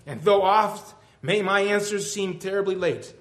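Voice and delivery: fervent recitation in God's voice, slowing toward the end; impassioned poem reading. Acted. God answering the weeping believer in verse, fervent recitation.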